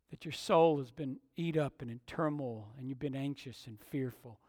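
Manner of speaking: soft, low, searching questions; quiet, earnest preaching; hushed pastoral appeal. Real, not acted. Preacher turns to searching personal questions.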